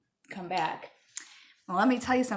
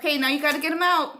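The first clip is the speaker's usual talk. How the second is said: louder, fast, higher command; raised mom voice; firm order; 8 semitones higher, 8 dB louder, much faster than usual. Real, not acted. Ordering her son.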